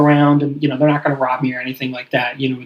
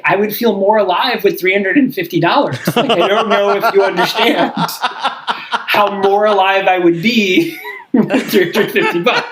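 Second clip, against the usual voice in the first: higher, laughing through the words; half-laughing comeback to mom; amused sarcastic reply while laughing; laughing retort; 4 semitones higher than usual. Retold or imitated.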